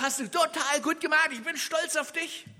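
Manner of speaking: high, loud enthusiastic praise; God telling a child he did great; proud praise. Retold or imitated. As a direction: cheer them on like a proud father. God voiced praising the listener.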